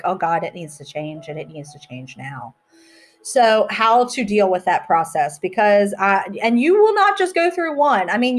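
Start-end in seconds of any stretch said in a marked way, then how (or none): 0.0-2.5 softer, lower self-talk; mild hushed dismay; quiet inner realisation. Retold or imitated. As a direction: murmur the sudden realisation to yourself. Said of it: "things that are like".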